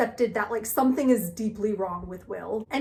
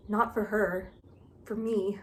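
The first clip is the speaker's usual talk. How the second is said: dramatic, mock brooding; 4 dB quieter, slower than usual. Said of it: "He's so dramatic".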